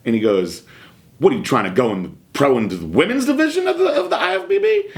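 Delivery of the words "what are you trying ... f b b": fast, climbing to a high-pitched jeer; mocking incredulity. Bodybuilder teasing a younger man over his doses, mocking. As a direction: imitate a ribbing question, pitch soaring as it goes. Retold ribbing over small dose.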